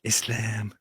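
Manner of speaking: Islam with a soft, Arabic-style s and a long a; lightly mocking Arabic pronunciation of Islam; mock Arabic accent. Host mocking the guest's Arabic pronunciation, in a light mock Arabic accent. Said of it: "I could also say Islam".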